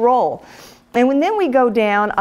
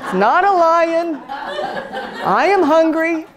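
laughing reply; 8 semitones higher than usual. Retold or imitated. Woman answering the child in church, laughing. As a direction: answer the child, much higher and giggling. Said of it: "And I went".

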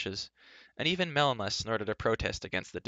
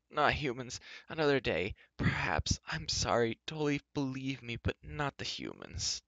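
moaning reluctance; 4 semitones higher than usual. A narrator's reading. Doorkeeper begs dwarf to keep humans out, moaning. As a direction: plead weakly and mournfully. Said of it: "gave a stifled moan".

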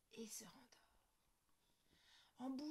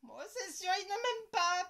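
sulky conclusion; 8 semitones higher, 10 dB louder than usual. Acted. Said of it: "pense Lola".

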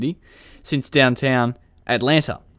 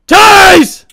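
sudden high, loud yell; officer yelling as he tases the runner; tase callout; 14 dB louder than usual. Pretend. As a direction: shout it as you fire. Officer tasing fleeing suspect.

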